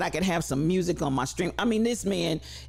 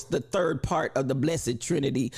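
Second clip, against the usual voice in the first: usual speaking voice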